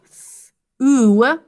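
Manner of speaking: "s, oo" as two isolated sounds; a hissed first sound, then a long vowel in "s, oo"; separate sounds. Shown as a demonstration. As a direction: sound out "s, oo" one by one. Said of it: "Next syllable".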